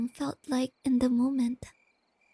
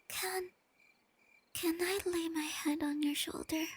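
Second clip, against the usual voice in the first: shy hush; 4 dB quieter than usual. Acted. Shyly asks to lean on shoulder.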